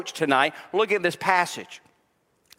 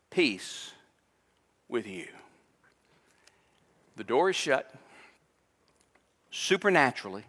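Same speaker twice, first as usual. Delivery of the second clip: tender hushed preaching; 7 semitones lower, 5 dB quieter than usual. Acted. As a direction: draw the congregation in close and gently. Tender preaching on Jesus' peace.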